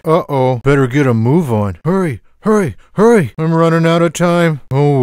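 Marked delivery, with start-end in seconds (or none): 1.8-3.3 urgent hurrying. Acted. Rushing against the clock.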